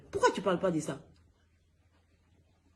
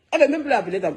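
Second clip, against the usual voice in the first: louder repeat; 5 semitones higher, 6 dB louder, much faster than usual. Real, not acted.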